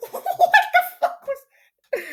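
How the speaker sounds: high squeaky laughing; words break up in real laughter. Real, not acted. Reader cracking up after screaming, laughing.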